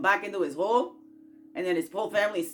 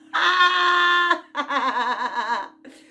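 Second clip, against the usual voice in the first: loud burst of laughter; 8 semitones higher, 9 dB louder than usual. Real, not acted.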